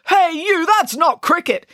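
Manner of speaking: high, loud shout of protest; calling after someone. Acted. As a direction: yell it down the corridor, indignant. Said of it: "Binky called after Patel".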